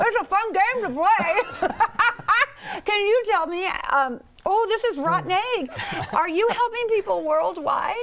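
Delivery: high, laughing through a foul taste; giggling, mouth still full; laughing while eating. Real, not acted.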